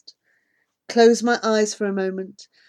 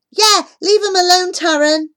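louder, higher call to the bully; 10 semitones higher, 7 dB louder than usual. Acted. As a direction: stand up for the new kids. Boy defending newcomers.

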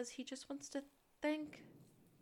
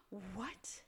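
gasp of surprise; 3 semitones higher, 7 dB quieter than usual. Real, not acted. Reacting to surprising fact.

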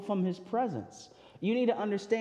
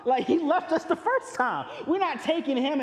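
higher, louder dismissive Paul; mock-dismissive Paul, higher pitched; Paul brushing him off; 8 semitones higher, 4 dB louder than usual. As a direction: toss off John Mark like a punk. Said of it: "And Paul's like".

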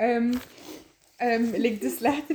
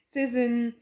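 ordinary talk

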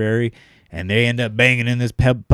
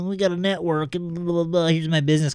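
networking nerd; 8 semitones higher than usual. Retold or imitated. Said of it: "Like, you know".

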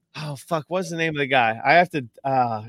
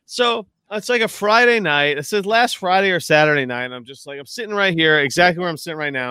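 ordinary delivery

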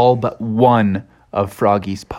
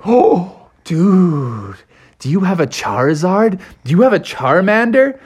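put-on raised, amazed kid-like asking; awed excitement; 5 semitones higher than usual. A narrator's reading. Friends see the Pokemon pogs, awed excitement. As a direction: gasp then ask in wonder.

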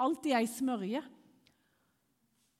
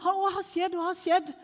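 high, loud, worried husband on the phone; acted-out panicky questioning; imitated alarm; 7 semitones higher, 6 dB louder than usual. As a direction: demand the news, fearing the worst. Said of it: "han trodde jo liksom at det var noen som var blitt alvorlig syk".

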